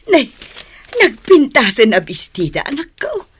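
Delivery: loud, high-pitched delight over the dress; delighted praise. Acted. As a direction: gush over the pretty dress. (naragsak unay a panangidayaw iti bestida)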